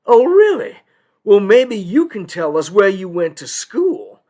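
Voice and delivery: loud, high-pitched skeptical challenge; disbelieving judge. Acted. Judge tests the lawyer's claim to be local, disbelieving. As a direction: call his bluff as a doubting judge. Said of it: "And he doesn't believe me".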